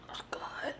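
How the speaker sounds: breathy whisper. Real, not acted. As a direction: whisper it in delight. Savouring honey.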